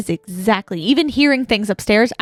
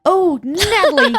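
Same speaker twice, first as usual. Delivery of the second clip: drawn-out high "Oh, Natalie"; mock outrage; 8 semitones higher, 3 dB louder, slower than usual. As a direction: scold her in playful shock.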